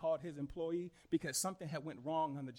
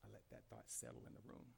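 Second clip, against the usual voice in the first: low, barely voiced aside; hushed; 8 semitones lower, 24 dB quieter, faster than usual. Real, not acted. Pastor letting a thought settle in the room, hushed. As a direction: drop right down to near whisper.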